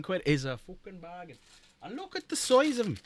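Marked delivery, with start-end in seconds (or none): 1.8-3.0 light Irish lilt, showing off; Irish accent. Pretend. Showing viewers the wound markers, light Irish accent. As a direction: marvel at them in a mild Irish accent. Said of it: "suddenly going Irish".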